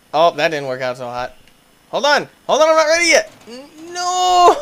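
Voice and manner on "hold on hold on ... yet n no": high, loud hold on, not ready; panicked protest. Real, not acted. Player caught off guard by the start, high alarmed yelp. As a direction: squeal in alarm.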